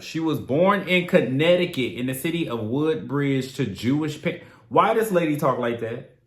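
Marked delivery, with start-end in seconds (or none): none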